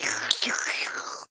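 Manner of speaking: imitated slurp at the bottom of a glass; noisy wet slurp, no voice; slurping noise. Shown as a demonstration. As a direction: suck up the last drops noisily. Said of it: "when you get to the bottom of a glass".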